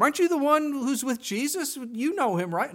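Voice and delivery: a suspicious stranger in the courtyard pressing the question; accusing bystander. Retold or imitated. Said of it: "the people started to recognize him".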